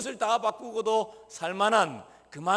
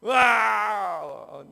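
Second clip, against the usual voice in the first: drawn-out mock child's wail; imitated child's wail; 6 dB louder, much slower than usual. Preacher imitating a crying child, drawn-out wail. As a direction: stretch out a bawling kid's cry. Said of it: "애들 어떨 때 막 기를 쓰고 막 울죠".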